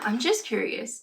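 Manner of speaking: ordinary talk, nothing marked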